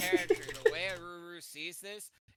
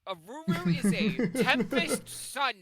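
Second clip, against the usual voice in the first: emphatic; 5 semitones higher, 10 dB louder, slower than usual. Real, not acted. Player heatedly defends character.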